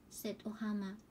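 ordinary delivery, nothing marked

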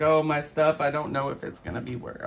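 high, fretful imitation of a nervous client; mock nervous client. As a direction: mimic the worried owner, lightly. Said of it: "she was so nervous. She's like".

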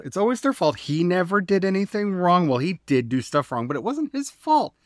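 mock the self-pitying sinner blaming everyone else; mocking narcissist. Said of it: "See the narcissism coming back in?".

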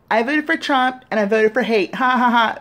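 high, loud, gleeful with a laugh at the end; smiling mock confession. Shown as a demonstration. Demoing for Trump voters the happy admission he wants. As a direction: say it grinning like you're proud. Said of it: "say it with a big smile on your face".

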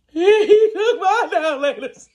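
high, loud wail on the edge of laughter; mock hysterical crying. Woman retelling her upset sister's complaint, mock hysterical. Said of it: "she's hysterical".

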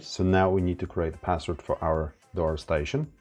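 ordinary delivery

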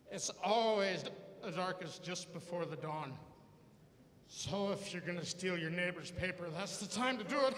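put-on drunk dad philosophizing. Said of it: "and he goes".

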